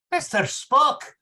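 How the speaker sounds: high, loud, slow cry of outrage; indignant outburst. Acted. Scotty outraged at Spock's remark, loud indignant cry. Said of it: "blazed with righteous indignation".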